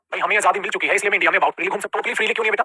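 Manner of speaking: ordinary talk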